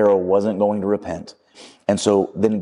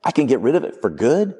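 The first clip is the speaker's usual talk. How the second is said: higher-pitched, excited disbelief rising into a question; elated; 7 semitones higher than usual. Acted. Man to angel who can kill his lizard, elated. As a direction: act out a man suddenly thrilled at the offer. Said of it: "like, elated. He's excited".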